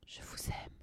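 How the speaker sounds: soft breathy whisper of love; under his breath. A narrator's reading. Narrator whispering his secret to Nadia. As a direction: slip the words out in a whisper. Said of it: "je dis à mi-voix".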